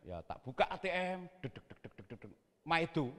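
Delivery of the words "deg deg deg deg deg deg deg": very soft, low ticking syllables; imitated keypad beeps. Preacher entering a PIN at the cash machine, hushed imitation. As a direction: tap out tiny key presses by mouth.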